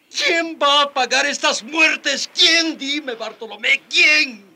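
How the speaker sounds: very high, loud wailing; anguished outcry. Acted. Survivor demands who will pay, anguished screaming. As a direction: scream the grief at him. Bereaved man demands answers.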